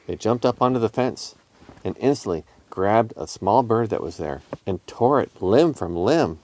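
ordinary delivery, nothing marked

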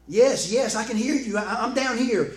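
loud, high, eager shout; excited calling up. Acted. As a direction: holler back to a far-off rescuer. Jack answers the voice from above.

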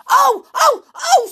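very high, loud bursts; enraged scream. A narrator's reading. Little man hearing his name, screaming in rage. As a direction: scream each cry in fury. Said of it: "screamed the little man in a rage".

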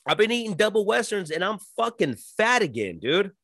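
high, chirpy mock compliment; imitating friends. Friends complimenting his weight loss, high and chirpy. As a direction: squeak out fake praise, pitched high. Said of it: "I'm like".

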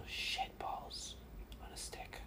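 whispered curse under breath. Real, not acted. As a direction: hiss it to yourself, barely audible. Muttered curse, fully unvoiced.

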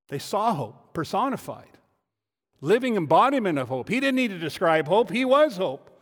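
rising, loud pulpit voice building up; full-force preaching. Acted. Pastor preaching to the congregation, voice swelling loud.

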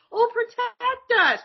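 high, slow helpless plea; mock damsel. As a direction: beg like a swooning damsel. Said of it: "We'd be like".

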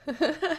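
short, high giggle; real, brief high-pitched laugh. Real, not acted. Guest greeted by host, short happy laugh.

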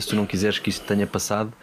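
ordinary talk, nothing marked

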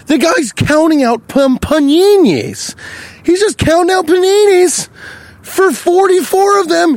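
very high, incredulous squawk; high-pitched outraged storytelling; exasperated rant. Retold or imitated. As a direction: squeal it in comic disbelief. Ranting about man counting coins.

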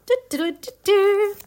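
ordinary delivery

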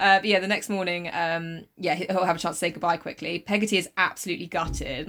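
ordinary talk

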